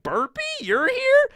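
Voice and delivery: very high, slow, clueless squeak; goofy Luffy. Retold or imitated. Voicing Luffy's surprised reply.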